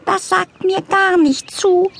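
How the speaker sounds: high, slow, haughty cat voice; whiny meow of a spoiled cat; grumpy cat. A narrator's reading.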